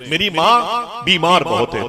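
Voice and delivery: slow, high retold plea; drawn-out imploring cry, acted; pleading. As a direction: plead slowly for a sick mother. Child pleads for sick mother.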